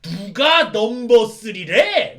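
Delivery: slow, loud, offended yell with a laugh; annoyed outburst. Acted. Satan Gundam insulted by the taunt, loud slow yell. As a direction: bellow it, insulted. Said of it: "열받아서 그러는 거야".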